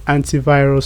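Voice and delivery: "antivirus" said as AN-tee-virus; British pronunciation. Shown as a demonstration. Talking to friend Jack as an example, British "AN-tee" in "antivirus". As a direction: give "antivirus" an "ee" after "ant". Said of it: "British pronunciation".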